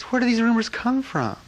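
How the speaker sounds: pitched high, mock puzzled master asking; incredulous question. Master demanding where the rumors came from, high and puzzled. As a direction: act baffled at where this came from.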